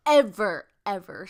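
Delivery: sudden loud burst on ever; real, emphatic outburst of volume; too loud. Real, not acted. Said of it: "sorry if that was so loud".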